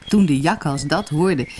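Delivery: usual speaking voice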